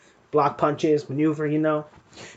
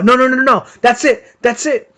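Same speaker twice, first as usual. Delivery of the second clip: higher, louder "No, no, no" breaking up a fight; retold stop-it shout; 8 semitones higher, 6 dB louder than usual. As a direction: call it off, raised and urgent. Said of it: "starts breaking up the fight".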